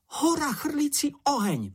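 very high-pitched little character exclaiming; high, amazed exclamation; Čenich's excited cry. A narrator's reading. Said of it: "vykřikl Čenich".

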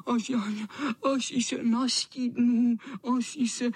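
high, broken whine with pauses; high miserable cat whine; whimpering tomcat. Acted. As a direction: whimper pitifully, stumbling over the start. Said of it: "vypadal velmi hubeně a kňučel".

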